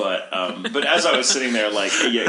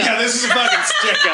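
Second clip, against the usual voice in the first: mock robber announcement; 10 semitones higher, 5 dB louder than usual. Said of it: "you're like".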